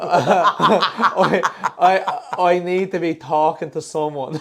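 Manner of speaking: laughing attempt at Irish; Irish accent. Shown as a demonstration. Asked to perform on the spot, stumbling Irish accent. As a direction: fumble into a put-on Irish accent. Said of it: "do something in Irish then".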